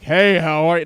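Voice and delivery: loud, big gruff-guy greeting; expected deep manly voice. Retold or imitated. Storyteller shows expected lumberjack greeting, loud and manly. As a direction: boom it out like a big man. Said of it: "supposed to have a really deep voice".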